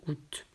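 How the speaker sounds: "août" said "oot", no A and no final t; just the vowel "ou" in "août". Shown as a demonstration. Teacher showing students "août" as "oot". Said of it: "juste O U T".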